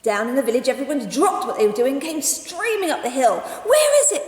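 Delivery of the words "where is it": high, loud urgent question; anxious villagers. Acted. Villagers arriving breathless, asking urgently. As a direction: ask in alarm. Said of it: "they asked".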